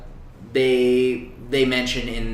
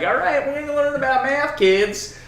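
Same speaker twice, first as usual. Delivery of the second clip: much higher, louder mock teacher voice; chirpy teacher act; 6 dB louder than usual. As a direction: greet the class in a high, put-on teacher's voice. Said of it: "he goes into class the next day".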